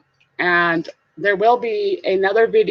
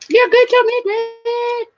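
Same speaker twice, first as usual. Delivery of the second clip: very high, playful squeak; high-pitched goofy singsong; silly high voice; 14 semitones higher, 3 dB louder, slower than usual. Pretend. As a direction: repeat it way up high with a long held ending. Silly high repeat to a friend.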